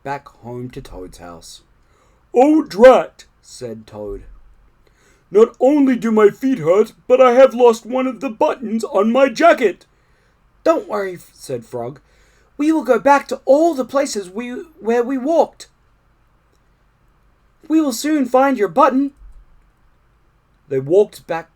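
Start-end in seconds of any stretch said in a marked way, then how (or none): 2.3-3.3 higher, louder grumbling toad voice; Toad, fed up, blurting it out; annoyed Toad. A narrator's reading. 5.3-9.9 higher, louder whiny toad voice; complaining Toad. A narrator's reading. Toad complains about sore feet. 10.6-19.1 high, louder friendly frog voice; reassuring Frog. A narrator's reading. Frog comforts Toad.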